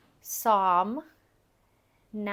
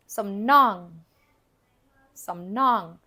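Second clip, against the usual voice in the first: "some nong" with "nong" louder and lifted; accent on second syllable; 7 dB louder, much slower than usual. Shown as a demonstration. Teacher models the word for learners, "nong" accented in "some nong". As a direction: lift the voice a little on "nong" in "some nong". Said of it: "your voice goes up just a little bit".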